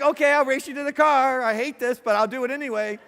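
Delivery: mock reluctant yes, sung out brightly; good-hearted reluctance. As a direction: play along with a sigh of good sport. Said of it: "We'd be like".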